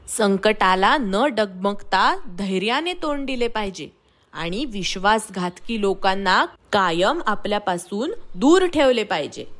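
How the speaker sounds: plain reading voice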